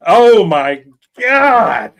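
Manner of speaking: loud slow groan of disbelief; drawn out in exasperation; exasperated. Real, not acted. Exasperated outburst.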